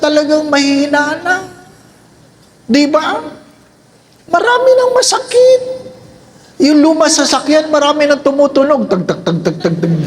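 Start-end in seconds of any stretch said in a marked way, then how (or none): 4.3-5.6 put-on high, pained old-person whine; playful old-person voice; aching old person. 8.9-9.9 rattling car noise. Pretend. Imitating a rattling old car.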